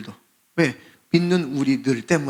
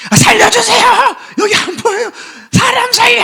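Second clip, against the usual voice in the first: very high, loud desperate shout for help; drowning man's scream; 9 dB louder than usual. Retold or imitated. Drowning man screaming to passers-by for rescue. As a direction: scream for your life from the water. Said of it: "물에 빠진 사람이".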